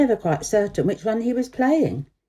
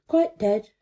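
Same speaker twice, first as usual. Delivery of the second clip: plain reading voice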